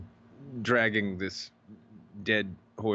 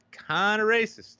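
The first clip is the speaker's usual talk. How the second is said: wry joke; 11 semitones higher, 4 dB louder, slower than usual. Real, not acted. Joking aside about product colors.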